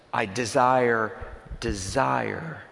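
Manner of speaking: slow "I desire, desire", the second "desire" rising; slow doubled "desire" to show the repetition; doubled for emphasis. Shown as a demonstration. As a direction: say "desire" twice, slowly, letting it echo. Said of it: "he says the same thing twice".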